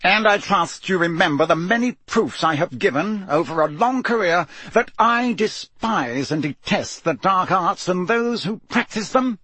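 a restrained shout, driving on insistently. A narrator's reading. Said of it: "Mister Crouch shouted".

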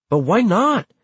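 high-pitched protesting demand; indignant challenge. A narrator's reading. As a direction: demand a reason, pitch jumping up. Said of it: "demanded Joaquin".

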